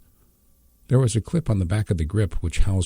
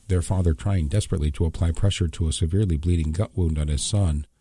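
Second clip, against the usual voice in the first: plain reading voice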